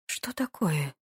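hushed, wary question; suspicious. A narrator's reading. Lady questions the duke's warning, suspiciously. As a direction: ask it softly, with suspicion. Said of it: "подозрительно уточнила Анна".

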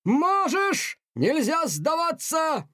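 high, slow shouted insistence; insistent shout. A narrator's reading. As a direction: refuse to let him quit. Настаивал полковник.

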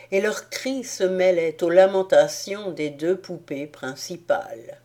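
ordinary delivery, nothing marked

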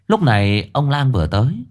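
plain reading voice

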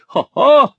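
high, loud theatrical ha-ha; gloating laugh. A narrator's reading. Husband gloating over rivals, high loud laugh. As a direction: crow it out in mock triumph.

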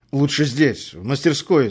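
plain reading voice

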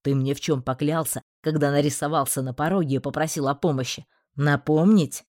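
low threatening voice. Acted. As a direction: threaten him through clenched anger. Magistrate's low angry threat.

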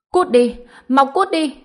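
loud, high-pitched yell to get out; rough angry shout. A narrator's reading. Girl throwing broken doll at little sister, angry shout. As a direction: lash out and drive the child away. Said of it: "Hạnh Hân thô bạo mở cửa".

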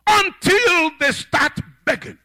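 high, loud pulpit cries; full-force preaching. Real, not acted. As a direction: thunder it out like a revival preacher.

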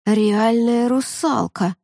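slow stunned voice; dazed and slow. Acted. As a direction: drag it out in a daze. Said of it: "заторможенно произнёс Жаров".

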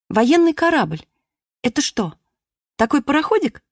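put-on child voice. Little Klara asks her sister.

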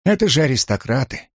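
plain reading voice

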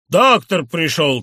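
loud, slow, jeering cry; a madman's loud, slow jeer; mocking shout. A narrator's reading. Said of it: "крикнул он и захохотал".

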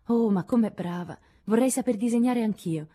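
gushing praise tinged with regret; admiring and wistful. A narrator's reading. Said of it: "con un misto di ammirazione e di rimpianto". (ammirazione un po' malinconica)

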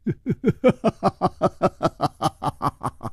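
long laugh falling in pitch; gloating laugh. Acted. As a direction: let a long string of ha-has tumble down from high. Detective laughs at suspect's panic.

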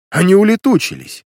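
high, loud outburst from the millionaire; angry shout. A narrator's reading. Millionaire fuming at the vanished detectives, angry shout. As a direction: cry it out crossly, pitch jumping up. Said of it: "сердито крикнул миллионер".

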